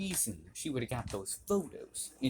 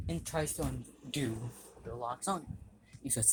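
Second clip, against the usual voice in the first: ordinary delivery, nothing marked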